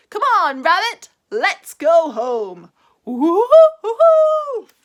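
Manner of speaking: high, loud bouncy call and whoop; Tigger whooping. A narrator's reading. Tigger leading Rabbit home, loud whooping. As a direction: whoop off joyfully.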